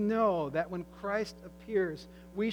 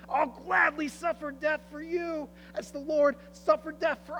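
crying out; 8 semitones higher, 7 dB louder than usual. Acted. Old John calling after the fleeing robber chief, pleading loudly. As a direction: call after him, begging him to stop. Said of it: "he cries out".

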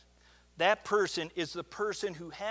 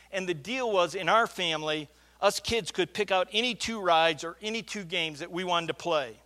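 ordinary talk, nothing marked

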